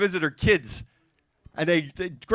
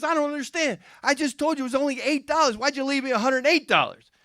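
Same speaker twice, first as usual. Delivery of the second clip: higher-pitched, acted bafflement; mildly puzzled questioning; 6 semitones higher than usual. Son asks father about the money, mildly puzzled. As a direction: press the question with light confusion.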